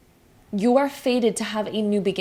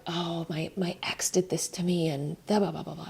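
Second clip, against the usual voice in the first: put-on whispered, dismissive retelling of old drama; mock whisper; 4 semitones lower, 8 dB quieter than usual. Mimicking someone who still dwells on an ex, whispered. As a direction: breathe it out like a tired old complaint. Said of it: "telling the story of, like".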